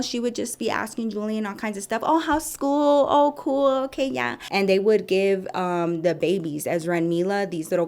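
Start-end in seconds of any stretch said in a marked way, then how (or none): 2.0-4.3 high, drawn-out put-on sweetness; fawning receptionist. Receptionist asking the son about school, fawning. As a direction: mimic over-interested small talk, high-pitched. Mimicking her chatting up Julian.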